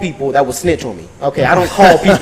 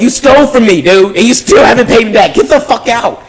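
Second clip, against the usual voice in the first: higher, louder angry demand; retold angry yell; 7 semitones higher, 6 dB louder than usual. Store owner telling the thief to get out, angry. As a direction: yell at him to pay up and leave.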